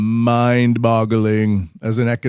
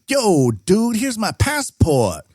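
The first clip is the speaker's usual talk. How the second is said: much higher, laid-back hip-hop dude voice; hip-hop guy; 11 semitones higher, slower than usual. Retold or imitated. Hip-hop fan customer hands over his passport, playing a laid-back hip-hop dude. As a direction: play a young Chinese hip-hop fan. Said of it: "talks like a hip-hop guy".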